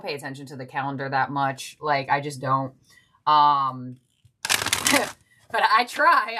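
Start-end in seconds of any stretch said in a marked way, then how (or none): 4.4-6.4 laughing. Real, not acted. Laughs at own excuse.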